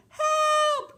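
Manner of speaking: very high, loud storybook scream; shrieked cry for help. A narrator's reading. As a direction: scream for help as a frightened Goldilocks. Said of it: "She screamed".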